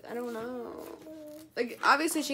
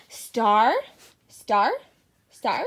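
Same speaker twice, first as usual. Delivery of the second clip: excited cheering; 9 dB louder, much slower than usual. Real, not acted. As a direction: cheer each word. Cheering baby on.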